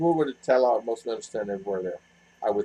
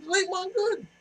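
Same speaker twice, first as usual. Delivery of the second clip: very high mimicking voice; parroting her phrase; 6 dB quieter than usual. Repeating her phrase back to R R, high-pitched parroting. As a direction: squeak it out in mock imitation. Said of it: "so I say the same".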